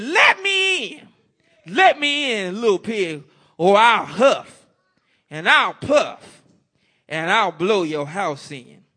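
hammed-up wolf threat; big bad wolf. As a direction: roar it at the straw house like the hungry wolf. Wolf demands at the pig's door.

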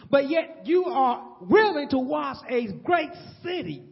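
God pressing the point home; a firm divine scolding; stern God. Acted. God rebukes Jonah.

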